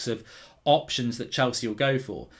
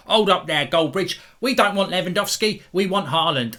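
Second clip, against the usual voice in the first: imitating a fan, slightly higher and louder; mock Chelsea fan voice; 6 semitones higher, 5 dB louder than usual. Vlogger mimicking an angry Chelsea fan, mock London accent. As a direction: put on a cartoon London fan voice. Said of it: "I don't know why I'm speaking like this".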